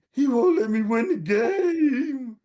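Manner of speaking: put-on high, slow, sobbing kid voice; crying child. Son sobbing his complaint to mom, high and slow. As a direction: blubber it out like a hurt ten-year-old.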